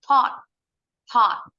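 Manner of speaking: taught said as "ot" with an open O vowel; teacher models taught; model pronunciation. Shown as a demonstration. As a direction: say taught firmly, "tot". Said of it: "bentuk kedua dan ketiganya sama".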